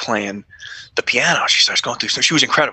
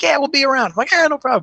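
cheerful exchange; 13 semitones higher, faster than usual. Retold or imitated. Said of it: "She's like".

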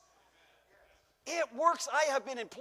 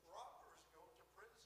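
aside; 8 semitones lower, 32 dB quieter than usual. Real, not acted. Aside to the bishop.

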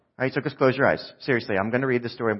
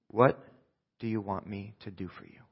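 soft, lower, with a pause after the first word; gentle question; 4 semitones lower, 10 dB quieter than usual. Acted. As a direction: ask it quietly and deliberately. Jesus's solemn question to blind man.

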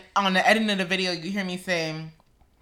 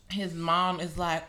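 ordinary talk, nothing marked

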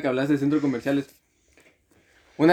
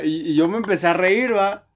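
ordinary talk

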